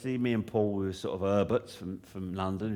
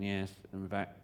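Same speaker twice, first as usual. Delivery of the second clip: flat quiet; 7 dB quieter than usual. Retold or imitated. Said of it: "he would just sit there going".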